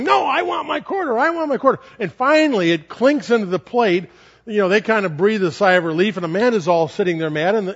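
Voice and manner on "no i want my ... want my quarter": put-on high whining little girl; whiny child. Little girl refusing the usher's plate, high whine. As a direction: whine it like a four-year-old clutching a coin. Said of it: "as Amanda's going".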